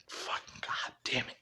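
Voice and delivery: breathy whispered swearing under the breath; whispered curse. Retold or imitated. Robin curses to himself about the robbery, whispered. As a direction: hiss the curse so nobody hears.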